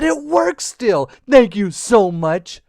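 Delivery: slow, emphatic happy exclamation; gushing creature gratitude; delighted lizard. Acted.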